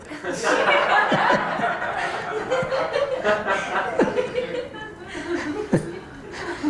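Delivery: big high laugh that rolls on; long real laughter; long hearty laugh. Real, not acted. Teacher laughs at own joke.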